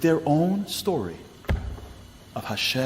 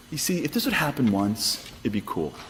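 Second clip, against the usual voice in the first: ordinary delivery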